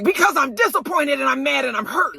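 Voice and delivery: imitated angry outburst. Marlo shouting at guests who mocked her party, angrily. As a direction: let her blow up, hammed-up. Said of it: "She was going off on them".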